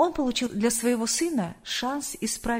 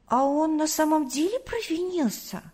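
put-on surprised child; 6 semitones higher than usual. A narrator's reading. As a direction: wonder aloud in a high little-boy voice. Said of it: "удивился Тимофей". (удивлённый детский вопрос, высоким голосом)

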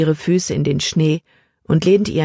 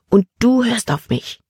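hushed order, pitched higher; annoyed whisper; 7 semitones higher than usual. A narrator's reading. Emma orders Libby to obey, cross and hushed. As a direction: lay down the law under your breath. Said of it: "flüsterte sie ärgerlich".

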